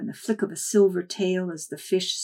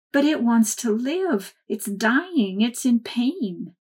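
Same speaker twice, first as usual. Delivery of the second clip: pleading; 5 semitones higher, 5 dB louder, slower than usual. Acted. Child pleads for dying fish.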